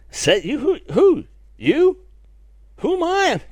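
high-pitched, disbelieving questions; incredulous. Acted. Preacher acting out the young prophet's reaction, incredulous. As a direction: squeak it out in mock disbelief.

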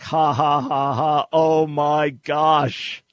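loud, deliberate ha-has, then drawn-out amazement; mock written laughter. Host reading a listener's embarrassed reply, hammed-up. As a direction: read typed laughter aloud, big and slow.

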